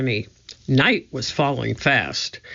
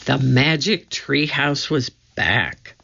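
ordinary delivery

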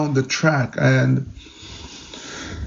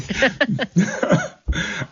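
laugh; 9 semitones higher than usual. Real, not acted. Laughing at own story.

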